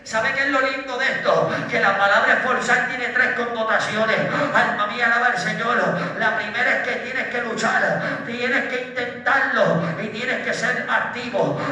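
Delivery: loud, driving preacher with punched praise interjections; full-force Pentecostal preaching. Acted. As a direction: fire up the congregation phrase by phrase. (predicación pentecostal a todo pulmón)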